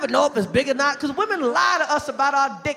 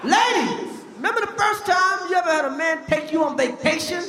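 ordinary delivery

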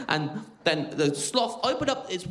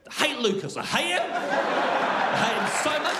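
mock hateful rant; 8 semitones higher than usual. Comic rant against a colleague.

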